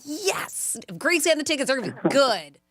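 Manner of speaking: high, eager mock excitement; mock excited. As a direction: gush with fake eagerness. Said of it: "I'm like".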